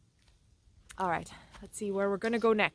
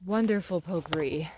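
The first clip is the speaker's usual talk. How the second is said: usual speaking voice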